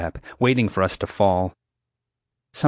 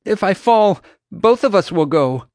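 loud, high, fearful warning; frightened raised voice; terrified; 10 semitones higher, 6 dB louder than usual. A narrator's reading. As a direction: warn urgently from mid-danger. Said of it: "she was terrified, but fighting to remain calm".

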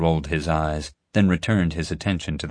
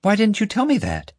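accusing; 4 dB louder than usual. A narrator's reading. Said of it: "Summer said accusingly".

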